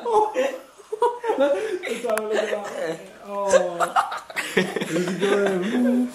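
high, breathless laughing. Real, not acted. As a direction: talk through fits of laughter. Helpless laughter watching him.

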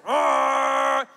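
loud held "Ah!" like a car horn; blaring horn imitation. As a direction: blast it like a stuck horn.

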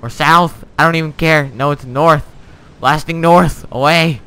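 loud, high excited yelling; excited shouting. Real, not acted. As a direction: shout it out in the thrill. Excited shouting while steering ship.

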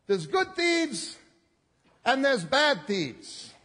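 slow, loud preacher's emphasis on good thieves and bad thieves. Real, not acted. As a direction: lean hard on "good" and "bad". Emphatic contrast in sermon.

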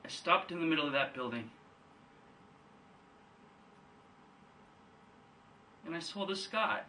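very soft, halting, long pauses between phrases; hushed recollection. Acted. Son telling his father what he saw, hushed. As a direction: drop right down and let each memory land. Monologue, Biff recalls seeing sky.

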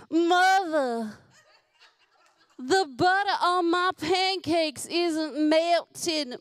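high, loud, slow Southern belle melodrama; dramatic daughter. Retold or imitated. Daughter complaining to mother about her pancakes, hammed-up dramatic drawl. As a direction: lament it grandly in a put-on Southern drawl. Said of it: "you are dramatic".

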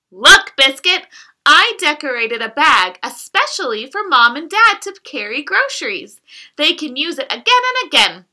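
lively, sing-song picture-book reading; reading to children. Acted. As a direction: read it like bedtime storytime for a toddler. Reads picture book aloud to child.

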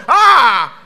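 high, very loud exclamation; mock amazed scream. Church member hearing son's name, mock amazed scream. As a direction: cry out in hammed-up awe.

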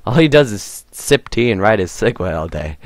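ordinary delivery

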